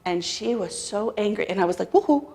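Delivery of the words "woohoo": a high, loud startled woohoo. Retold or imitated. Narrator startled as the mother nearly lunges across the table. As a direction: yelp it out, caught off guard.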